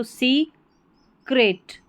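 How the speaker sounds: Secret split slowly as "सी... crate"; broken into syllables. Shown as a demonstration. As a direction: pause between सी and crate in secret. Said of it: "तोड़ के pronounce करना".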